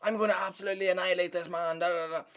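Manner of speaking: a hint of cocky fighter hype, sped up; mocking McGregor trash talk. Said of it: "You can't trash talk being like".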